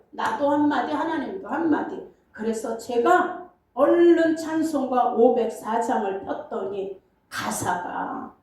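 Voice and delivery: ordinary talk, nothing marked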